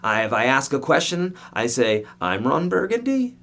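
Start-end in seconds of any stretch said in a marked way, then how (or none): 2.2-3.4 a light imitation of an anchorman, sliding up high; lightly voiced news anchor introducing himself; teacher imitating the Anchorman line, rising pitch; Ron Burgundy line. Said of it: "like Ron did in Anchorman".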